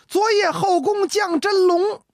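slow, high, ringing recitation of a poem line; recited verse. Acted. Ji Xiaolan recites his flattering verse to the emperor, slow and high.

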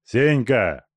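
repeated call. Acted. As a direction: call him again, harder. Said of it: "повторил Тумба". (повторный окрик)

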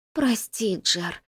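husky, fading near-whisper; hoarse rustling whisper. A narrator's reading. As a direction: rasp it out softly, almost gone. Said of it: "осипшим голосом прошелестела Арлина". (осипшим голосом, шелестящим шёпотом)

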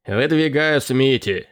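understated call-out, drawn out and slow; mild shout. A narrator's reading. Pirate nominating his candidate before the crew, mild shout. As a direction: call out a nomination slowly, without full volume. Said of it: "крикнул Фифи Луош".

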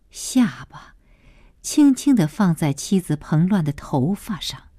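ordinary delivery, nothing marked

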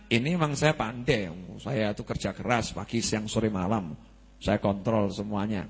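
low, quiet smug voice of a man crediting himself; boastful self-praise. Retold or imitated. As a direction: play the proud earner who forgets God. Boastful man taking credit for wealth. (suara sombong yang pelan dan rendah)

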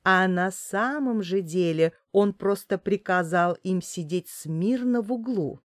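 usual speaking voice

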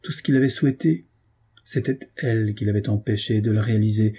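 usual speaking voice

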